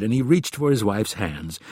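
plain reading voice

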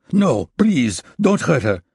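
high, loud, frightened begging; a husband pleading for his wife at gunpoint; desperate plea. Acted. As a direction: beg him to spare her.

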